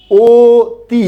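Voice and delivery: অতি stretched long, first vowel closed like ও; drawn-out অতি. Shown as a demonstration. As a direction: hold the অ of অতি long and round it to ও. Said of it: "আপনি আমার সাথে বলেন".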